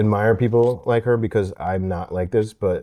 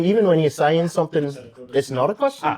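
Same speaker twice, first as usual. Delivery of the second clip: Australian accent, rising like a question; every Australian line lifts high at the end; 6 semitones higher than usual. Shown as a demonstration. Explaining the Australian accent to friend, Australian lilt. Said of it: "the key with Australian".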